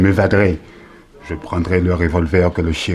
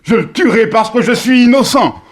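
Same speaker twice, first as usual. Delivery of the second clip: rising higher and louder into a sworn oath; desperate furious vow; 10 semitones higher, 4 dB louder than usual. Acted. Accused prisoner vowing to his cellmate to kill. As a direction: swear it before God with everything.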